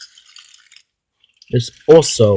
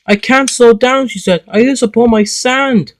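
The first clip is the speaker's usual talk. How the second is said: high, loud panicked cry as the brakes fail; panicky cry; 11 semitones higher, 7 dB louder than usual. Acted. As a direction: shout it out in alarm, much higher and louder. Engine can't stop, out of sand.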